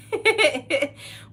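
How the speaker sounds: high burst of real laughter. Real, not acted. Host laughs at herself for crying at a film, real laughter. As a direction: laugh it off, embarrassed.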